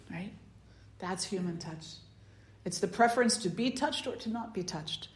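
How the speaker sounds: ordinary talk, nothing marked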